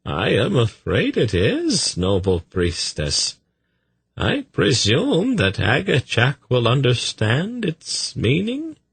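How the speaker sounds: high, swooping, ingratiating voice; deferential messenger. A narrator's reading. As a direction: play a polite visitor addressing a priestess.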